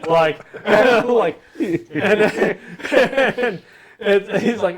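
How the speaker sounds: normal talking voice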